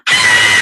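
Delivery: sudden high shriek of fright; startled scream. Retold or imitated. Retelling the window scare, she shrieks in fright. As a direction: scream as something hits the window.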